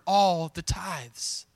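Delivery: "all the tithes" drawn out very slowly, "all" weighted; stress on all the tithes. Shown as a demonstration. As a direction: linger on "all", underline it with the voice. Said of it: "I want you to underline that word".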